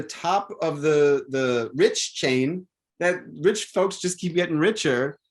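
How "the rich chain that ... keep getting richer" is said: ordinary delivery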